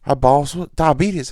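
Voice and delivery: coworker voice. Retold or imitated.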